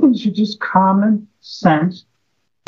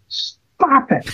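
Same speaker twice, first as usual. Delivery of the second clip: mock yell; 8 semitones higher than usual. Psychiatrist leans forward at his patient, high mock yell. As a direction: jump high into a pretend yell. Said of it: "he yells at the top".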